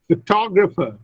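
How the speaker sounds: "photographer" stressed on -TOG-; stress on second syllable. Shown as a demonstration. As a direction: say "photographer" as fuh-TOG-ruh-fer. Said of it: "stress shifts from the first to".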